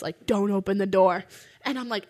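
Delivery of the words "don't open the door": imitating a loud, deep warning voice; loud low-pitched voice. Mysterious voice warns the dreamer at the cabin door, loud and low-pitched. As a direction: boom out the warning, slightly lower. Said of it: "really loud, like, almost, like, low-pitched voice".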